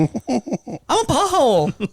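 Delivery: wound-up little Southern voice; Dolly. Acted. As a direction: chirp it in a high Southern accent. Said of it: "That's Dolly's voice".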